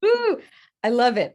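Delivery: very high, loud squeal of joy; delighted ooh. Real, not acted. Reacting to her friend's idea with a high, loud squeal. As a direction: squeal with glee.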